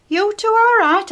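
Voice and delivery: high, loud enquiring call; workman calling in. Retold or imitated. Man in overalls calling to the couple in the lift, loud and curious. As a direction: call in to the pair through the doors.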